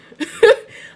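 big high laugh breaking out; loud burst of laughter. Real, not acted. Vlogger cracking up at her own country slang, loud laugh.

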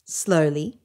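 ordinary delivery, nothing marked

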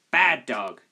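loud, sharp telling-off, falling at the end; farmer's wife scolding in a read-aloud story. A narrator's reading. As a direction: scold a naughty dog loudly. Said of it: "scolded the farmer's wife".

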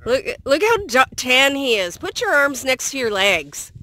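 loud, high, playful teasing; calling out to show him off; excited calling out. Real, not acted.

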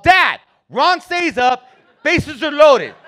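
high, loud, stretched protest; urgent kid yelling. Retold or imitated. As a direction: call out to dad, desperate for the game. Child urging dad to watch game.

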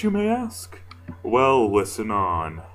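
plain reading voice

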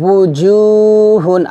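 উজুহুন chanted very slowly with a thin, light হুন; light, thin recitation. Shown as a demonstration. Teacher showing students the light হুন in উজুহুন. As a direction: draw out উজুহুন keeping the হু light. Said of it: "পাতলা করে বলবেন".

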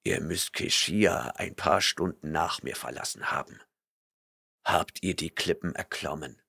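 stern barbarian rebuking a liar; gruff barbarian. A narrator's reading. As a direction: rumble your disgust. Conan's voice.

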